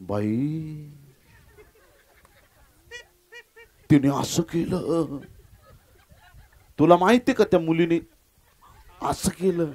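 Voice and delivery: lightly voiced gossip, drawing words out; gossiping woman. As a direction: spill gossip with drawn-out, scandalized words. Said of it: "एखाद्याची निंदा करा". (चुगली करणाऱ्या बाईचा हलका आव)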